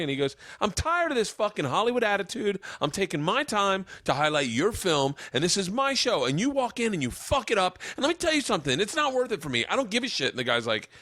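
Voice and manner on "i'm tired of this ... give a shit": imitating a radio host telling off a guest; hammed-up outrage, pitch up but volume steady; retold angry rant. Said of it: "And he starts berating the kid".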